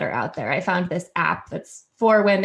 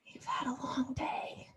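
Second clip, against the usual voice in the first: soft whisper, tucking someone in; breathy hush of an audio story; whispered bedtime voice; 6 semitones higher, 15 dB quieter than usual. Retold or imitated. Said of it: "just says".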